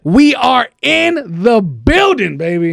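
slow high drawn-out hype; hype call. Acted. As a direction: stretch it out like a crowd call.

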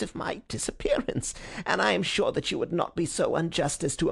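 high, hushed whining plea; nasal whine. Acted. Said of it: "he whined incessantly".

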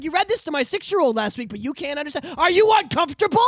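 ordinary talk

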